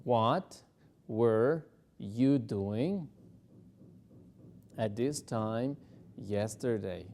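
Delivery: slow chunked drill; slow broken drill. Shown as a demonstration. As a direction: break it into chunks slowly. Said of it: "Vamos a practicar la conversación".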